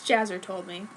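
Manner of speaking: ordinary delivery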